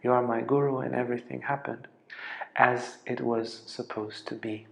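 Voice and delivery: hushed, tearful and moved; quiet retold weeping, understated. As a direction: speak it softly as if close to tears. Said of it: "he starts crying and he says".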